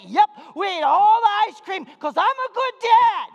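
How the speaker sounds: proud, high, full-volume retort; hammed-up triumphant dad shout. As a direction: holler it as a proud boast. Triumphant retold answer to the mother.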